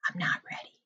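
whispered, half-awake protest; groggy whisper. Retold or imitated. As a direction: whisper it like you just woke up. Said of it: "you're like".

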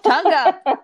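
loud, high, playful command; excited. Acted. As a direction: call it out brightly. Playful instruction, repeated.

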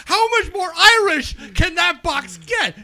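loud, high exasperated outburst; heated comic rant. Real, not acted. Arguing with friends over Irish potato candy, heated loud rant. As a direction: rant at full volume, voice pushed way up. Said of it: "Your rant's going downhill pretty quick".

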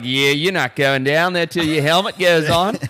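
hammed-up Australian guide, lifting at the end; Australian accent. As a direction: lay down the rule as an Australian bloke. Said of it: "And the guy's like".

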